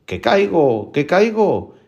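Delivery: lightly voiced eerie call from above; mysterious voice. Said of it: "dice al rato otra vez".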